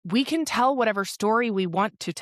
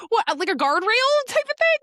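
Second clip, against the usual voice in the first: much higher, louder laughing voice; laughing question; 14 semitones higher, 5 dB louder than usual. Real, not acted. Asking listeners for the word guardrail, high laughing question. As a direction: squeal it through the laughter.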